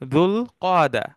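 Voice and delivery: যুল কাদা said as Arabic "dhul qa'da", with a clear q; careful Arabic যুল কাদা, not the Bangla জিলকদ; teacher modelling যুল কাদা slowly and distinctly; correct Arabic pronunciation. Shown as a demonstration. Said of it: "আসলে শুদ্ধ উচ্চারণ হচ্ছে যুল কাদা".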